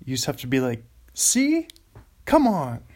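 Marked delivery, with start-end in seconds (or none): 1.2-2.8 high, loud hype "See? Come on"; much higher, louder self-cheer; pumped up. Shown as a demonstration. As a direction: sell your own joke to the mic. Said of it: "pump yourself up about the callback".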